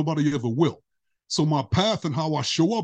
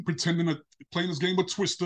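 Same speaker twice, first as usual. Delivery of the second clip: normal talking voice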